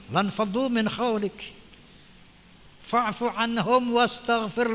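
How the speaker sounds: measured chanted recitation of the verse; Quran recitation. Acted.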